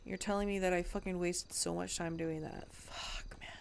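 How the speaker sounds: whispered, exasperated; frustrated whisper. Real, not acted. Telling viewers her work was wasted, in a frustrated whisper. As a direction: breathe it out under your breath in disbelief.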